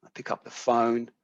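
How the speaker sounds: normal talking voice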